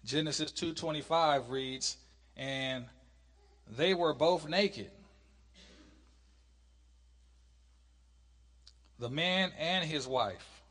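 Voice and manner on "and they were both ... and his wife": slow, drawn-out Bible reading; scripture reading. Acted. Teacher reads of the man and wife in Genesis, scripture reading. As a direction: read the verse out in spaced, stressed phrases.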